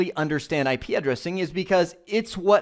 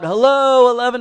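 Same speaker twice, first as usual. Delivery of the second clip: loud, high hail into the distance; yelling out; 8 semitones higher, 8 dB louder than usual. Pretend. Computer trying to call a host outside its network, a loud yell. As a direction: shout the name as if across a street. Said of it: "I can't yell out".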